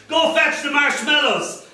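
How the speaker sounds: high-pitched order from a frantic mum; high comic screech. Acted. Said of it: "Mum screeched".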